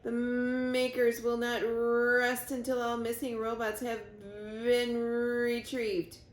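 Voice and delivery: slow, broken, stammering machine voice; dying robot warning Roz, slow and stuttering; garbled dying robot. Acted. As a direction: force out each word as the circuits fail. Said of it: "in a quiet, garbled voice".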